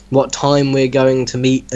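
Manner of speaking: normal talking voice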